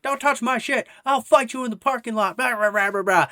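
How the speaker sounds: mock angry yell, pitched high; high, loud, hammed-up threat; screaming Karen. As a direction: imitate a ranting Karen, trailing into blah-blah. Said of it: "Just screaming at".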